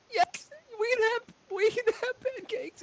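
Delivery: very high, choked-up voice; emotional halfling mother. Acted. Mother answering her tearful child, emotional. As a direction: reassure your little one through emotion.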